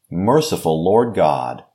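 normal talking voice

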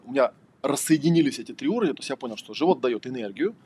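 ordinary talk, nothing marked

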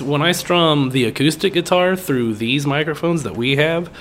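ordinary talk

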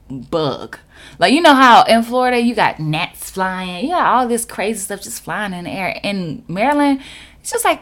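ordinary delivery

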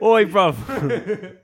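put-on loud, high, laughing British geezer accent; British street accent through laughter; mock British accent. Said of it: "since you used the accent".

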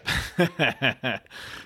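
short wry laugh; self-mocking chuckle. Real, not acted. Entrepreneur laughing at his early optimism, self-mocking chuckle. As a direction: chuckle at your own naivety.

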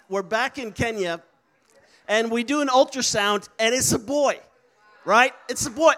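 ordinary delivery

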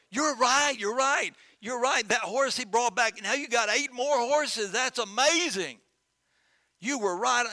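high, loud, gushing neighbors; excited neighbors. Acted. Neighbors gushing to the farmer over the returned horses, high and loud. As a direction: rave about the eight new horses.

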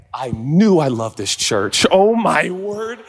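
usual speaking voice

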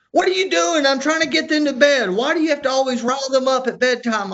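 high, loud imitated scolding; wife yelling. Wife to husband riling kids at bedtime, yelling. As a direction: mimic an exasperated wife telling you off. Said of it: "my wife's yelling at me".

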